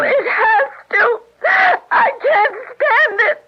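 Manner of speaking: high, shouting panic rising to a wail; panicked cry. Acted. Mona trapped behind the door, crying out in high panic. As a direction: scream it out, trapped and losing control.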